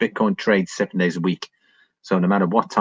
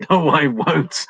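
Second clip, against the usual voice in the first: slow, higher, laughing comeback; laughing mock reply; 8 semitones higher, 4 dB louder, slower than usual. Real, not acted. Mock-answering Mister Dave's visa jab, laughing slowly. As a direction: answer him while cracking up.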